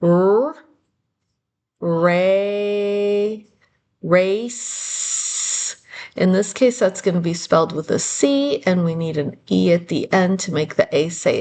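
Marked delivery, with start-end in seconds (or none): 0.0-5.8 R, ray, race built up sound by sound; sounding out words. Shown as a demonstration. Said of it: "we have to race".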